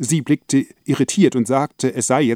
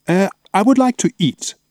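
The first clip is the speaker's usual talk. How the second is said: simplified English; 8 semitones higher, 3 dB louder than usual. Acted.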